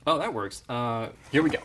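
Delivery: normal talking voice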